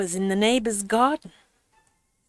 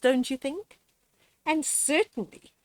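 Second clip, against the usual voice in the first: ordinary delivery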